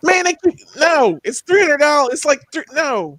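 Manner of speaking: high-pitched animated objection; exasperated protest. Real, not acted. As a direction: protest the price, voice jumping up. Protesting the price of a toy.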